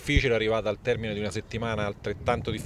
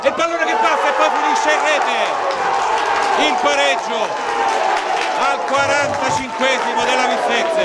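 high, loud goal call; breathless italian goal commentary; 13 semitones higher, 9 dB louder than usual. Real, not acted. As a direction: shout out the equaliser. Goal is scored.

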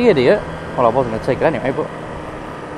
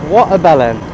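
usual speaking voice

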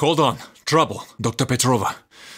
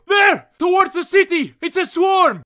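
high loud warning yell; loud urgent callout; alarmed shout; 9 semitones higher, 3 dB louder than usual. Acted. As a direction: shout to point out the swarm.

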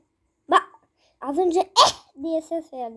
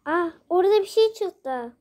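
ordinary talk

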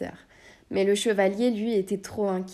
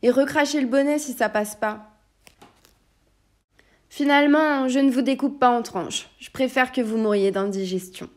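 angry taunt; 5 semitones higher, 6 dB louder than usual. Acted. Furious knight taunts magician.

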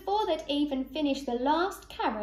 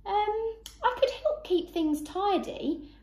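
tentative suggestion; 8 semitones higher than usual. A narrator's reading. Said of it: "Rupert suggested".

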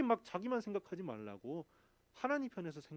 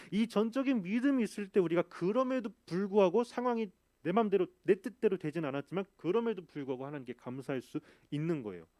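full-force preaching; 3 semitones higher, 7 dB louder than usual. Real, not acted.

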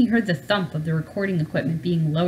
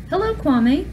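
a kind, higher greeting for the professor; warm high voice; 9 semitones higher, 4 dB louder, slower than usual. A narrator's reading. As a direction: greet the boy gently, a little higher. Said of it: "in a warm, high voice".

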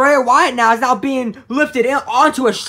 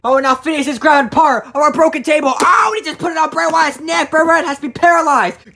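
raised, urgent play-by-play; excited wrestling commentary; 6 semitones higher, 5 dB louder than usual. Acted. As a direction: call the sudden turnaround as it happens. Calls the attack.

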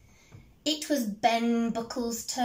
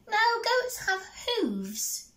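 high bouncy rabbit voice; little rabbit; 12 semitones higher than usual. Acted. Rabbit answers the troll under the bridge, high squeak.

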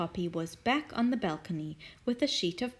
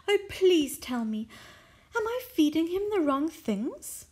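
eager pleading lady; 8 semitones higher, 4 dB louder than usual. Acted. Mrs Silver begging.

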